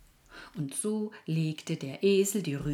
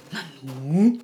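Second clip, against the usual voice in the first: surprised; 11 dB louder than usual. Acted. Donkey finds the carrot.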